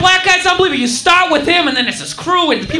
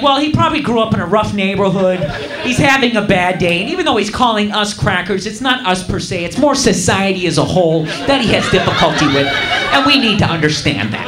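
understanding liberal voice; 8 semitones lower than usual. Retold or imitated.

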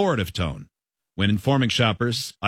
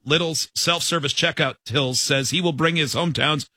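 ordinary delivery

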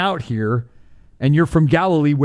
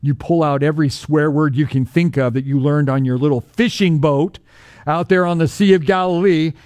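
ordinary delivery

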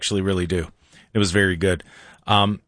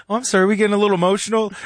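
higher, fast, teasing Oh, I'm sorry; mocking quote; 6 semitones higher, much faster than usual. Host replaying a guest's jab to his co-host, fast and higher. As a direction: toss it off quickly in a sing-song tease.

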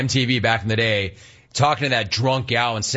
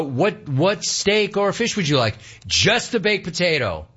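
ordinary talk

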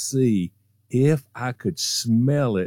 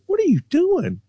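much higher, startled mom; imitating his mother catching him; a high mock mom voice calling out; mom's voice; 3 dB louder than usual. Mom voiced in retold story.

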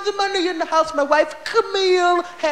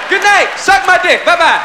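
louder, tossed-off curse act; mock shouted sign-off; 5 dB louder than usual. Comic mocking a curse-only act, loud mock sign-off. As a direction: fling it at the crowd as a joke. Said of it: "walk out and say".

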